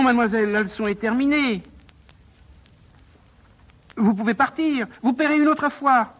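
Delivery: high-pitched frantic wailing; panicked. Acted. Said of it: "pris de panique".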